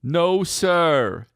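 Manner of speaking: mock [name]. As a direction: say it proudly. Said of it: "he said".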